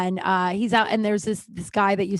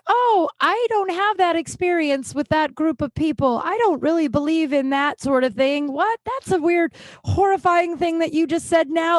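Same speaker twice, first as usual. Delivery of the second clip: high, tense forced politeness; straining not to flip out; 9 semitones higher, 5 dB louder than usual. Retold or imitated. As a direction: object politely while holding back outrage. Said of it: "doing my best to not flip out at her".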